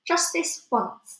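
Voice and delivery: slow, high-pitched giving in; drawn-out, lifted character voice; conceding giant. Acted. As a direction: draw it out as a one-time concession. Villain gives in grudgingly.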